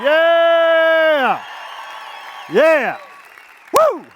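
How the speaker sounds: loud high yeah and woo; excited crowd-pumping cheers; cheering yells. Real, not acted. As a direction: whoop it up for the band. Cheering after the song.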